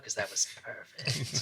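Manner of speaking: slow whispered aside. Pretend. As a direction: breathe it out quietly. Whispered joke.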